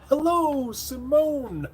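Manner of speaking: very high squeaky greeting; playful high mimicry of a viewer; impression of Simone. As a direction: pitch right up for a teasing impression. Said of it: "I'm gonna do the impression of you".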